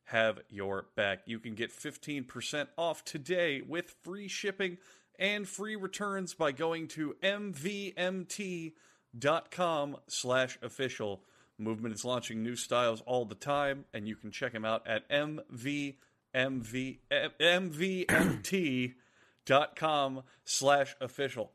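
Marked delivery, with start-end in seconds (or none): 1.5-11.2 spelling out the web address slowly; slow ad read. Acted. Host reading a sponsor URL slowly, deliberate. 15.1-21.5 slow ad read. Acted. Host repeating the sponsor URL, slow and emphatic.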